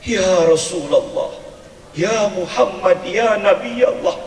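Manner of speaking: dreamy drawn-out calling in one's sleep; mock sleep-talking. Wife sleep-talking, calling the Prophet, mock dreamy. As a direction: murmur the names like a wife dreaming. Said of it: "Ibu ngigonya begini".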